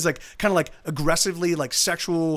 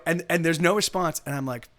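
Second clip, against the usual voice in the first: ordinary delivery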